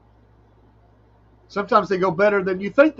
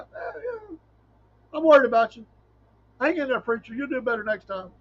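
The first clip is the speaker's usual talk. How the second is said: pitying churchgoer; 7 semitones higher than usual. Retold or imitated. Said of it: "And they're like".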